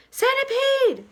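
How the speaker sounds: long, high, loud call; shouted. Acted. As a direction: call him up from the tunnel, drawn out. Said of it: "they shouted".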